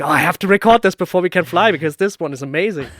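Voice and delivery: mock-excited colleague who can't wait to record. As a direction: replay the colleague's thrilled rush to record. Colleague excited over sink sound.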